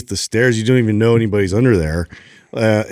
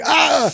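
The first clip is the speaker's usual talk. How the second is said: high-pitched startled "ah"; startled yelp; 14 semitones higher than usual. Retold or imitated. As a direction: yelp as if grabbed on the stairs. Startled walking by the stairs.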